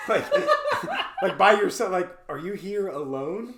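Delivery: laughing through the words; words shaken by laughter. Real, not acted. Laughing while retelling.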